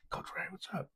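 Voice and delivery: high, unvoiced whisper of a kid saying hello; whispered kid greeting. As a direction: breathe it out as a hushed hello. Kid greets coach at game.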